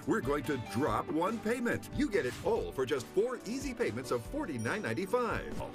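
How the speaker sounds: ordinary talk, nothing marked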